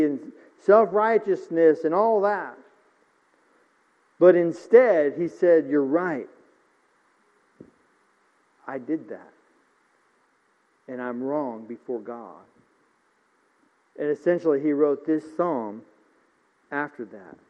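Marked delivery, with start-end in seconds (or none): 5.7-12.4 much softer, lower, contrite; humble confession. Retold or imitated. Preacher voicing David confessing to the prophet, soft and contrite. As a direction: hush it down like a repentant confession. King admits sin to prophet.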